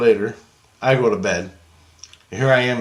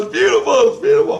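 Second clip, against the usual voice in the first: ecstatic scream; 13 semitones higher, 5 dB louder than usual. Retold or imitated. Jesus admiring the car, screaming with delight. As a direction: squeal over the car like a fan. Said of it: "he's screaming".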